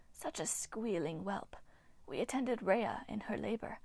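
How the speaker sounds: eerie breathy whisper of an ancient nymph; ghostly nymph whisper. Acted. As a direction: hiss it like a withered water spirit. Nymph Hagno speaks.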